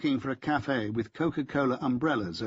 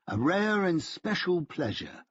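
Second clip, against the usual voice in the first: slow, higher, courteous character voice; Solomon's voice; 7 semitones higher, slower than usual. A narrator's reading. Solomon greeting his old colleague, slow and courtly. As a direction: lift the pitch and savour each word.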